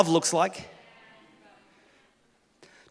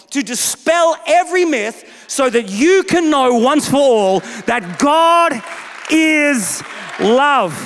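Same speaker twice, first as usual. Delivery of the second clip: preaching at full force; 3 semitones higher than usual. Acted. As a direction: drive each phrase home from the pulpit.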